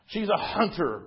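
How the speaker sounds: emphatic, lightly acted roar; roaring lion. A narrator's reading. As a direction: proclaim it as the lion, in words. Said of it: "roared the lion".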